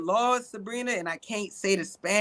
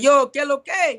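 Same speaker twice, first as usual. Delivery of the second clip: put-on higher, louder Spanish-accented hail; 7 semitones higher, 5 dB louder than usual. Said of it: "I just use the accent".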